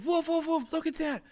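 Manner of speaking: high, loud yappy dog voice; barking little dog. Acted. Little dog barking at you, high yapping voice. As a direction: bark it out like an excited little dog. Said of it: "The other dog going".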